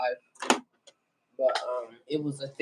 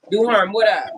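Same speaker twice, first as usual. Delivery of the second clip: higher, louder hail to a friend; loud calling greeting; 7 semitones higher, 9 dB louder than usual. Real, not acted. Streamer greeting guest Duharm with a louder, higher hail. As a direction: call his name out across the room.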